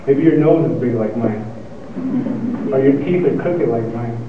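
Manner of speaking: ordinary delivery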